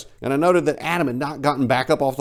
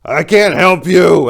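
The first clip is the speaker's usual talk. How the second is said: honest yell; 5 semitones higher, 11 dB louder, slower than usual. A narrator's reading. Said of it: "I very honestly said".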